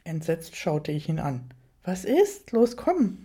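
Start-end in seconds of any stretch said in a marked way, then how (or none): 1.8-3.2 higher, mildly impatient urging; somewhat more insistent. A narrator's reading. Rainer hurrying his hesitant companion out, mildly insistent. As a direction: urge them along with some emphasis. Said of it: "meinte er etwas nachdrücklicher".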